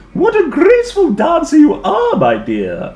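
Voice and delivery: loud, high and laughing praise; delighted teacher laughing with praise; exclaiming. Acted. Teacher exclaims to the dinosaur, laughing. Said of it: "Madame Lucille exclaimed".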